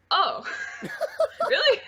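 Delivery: squeaky high surprised oh really, with a laugh; mock surprise. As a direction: act amazed, laughing through it. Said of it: "and I was like".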